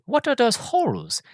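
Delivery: high, innocent put-on voice; Enrico. Puzzled passenger inspecting the heart, high innocent voice. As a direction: mimic a naive man asking, pitched right up. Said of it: "you got Rowan's character".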